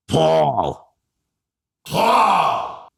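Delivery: high, drawn-out call to a husband; high-pitched elderly New York wife; Brooklyn wife. Retold or imitated. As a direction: call out the name in an exaggerated Brooklyn woman's voice. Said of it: "when I do this woman's voice".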